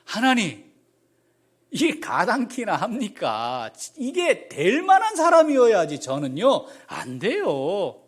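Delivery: loud, high protest to God; desperate old man crying out he can't; Moses pleading. Acted. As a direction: beg God to pick someone else. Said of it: "그래서 표현을 이렇게 하는 겁니다".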